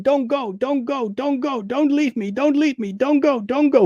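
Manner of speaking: high pleading don't go, repeated and desperate; mock a child begging her dad not to leave; imitating hysterical screaming. Retelling a film, voicing the girl begging her father, high mock wail.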